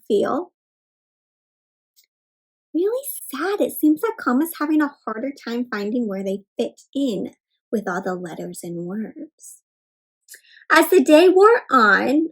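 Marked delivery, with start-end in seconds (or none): 2.7-9.6 soft, sinking, sorry voice; quiet, downcast trailing delivery; sympathetic sadness. Acted. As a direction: gently feel sorry for someone left out. Sympathizes with sad comma.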